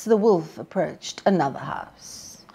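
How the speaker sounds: usual speaking voice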